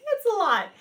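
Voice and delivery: overwhelmed and amused; high, loud, laughing complaint; laughing exasperation. Real, not acted. Repeating it through laughter.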